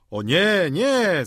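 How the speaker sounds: loud, rising cry of refusal; group outcry. A narrator's reading. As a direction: shout it as a protesting crowd would. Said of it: "zawołali wszyscy stołownicy". (głośny okrzyk sprzeciwu)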